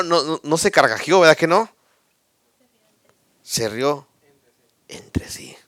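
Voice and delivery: usual speaking voice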